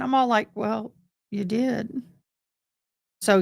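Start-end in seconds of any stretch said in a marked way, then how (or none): 0.5-2.0 slow, softer deadpan retort; calm, slow, softer put-down to the yelling driver; flat comeback. Retold or imitated. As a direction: drop it quietly and slowly, unbothered. Her comeback in the quarrel.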